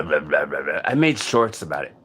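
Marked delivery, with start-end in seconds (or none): 0.0-0.8 low droning mumble. Retold or imitated. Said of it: "it's just a ruh".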